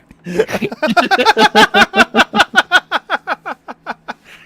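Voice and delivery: loud laugh. Real, not acted. Laughing at the impression.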